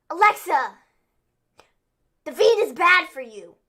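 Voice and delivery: imitating an urgent warning; mock urgent plea. Host mimics Nikki Cross urging Alexa loudly. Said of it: "she was all like".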